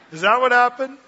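loud question through real laughter; laughing. Real, not acted. Preacher laughing at his own Noah joke, loud. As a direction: laugh it out, tickled.

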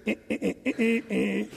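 soft little teasing "eh, eh, eh"; short repeated taunting squeaks; mock taunting noise. David taunting while holding the spear.

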